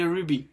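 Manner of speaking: ruby said as "roo-bee"; English ruby ending in "ee"; preferred pronunciation. Shown as a demonstration. Teacher picks the best option, ruby pronounced "roo-bee". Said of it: "le mieux phonétiquement".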